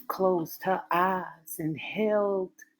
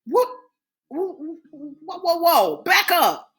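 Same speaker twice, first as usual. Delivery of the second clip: much higher, louder indignant demand; shocked outrage; 11 semitones higher, 5 dB louder than usual. Acted. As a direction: she can't believe what she heard. Eve shocked at his confession.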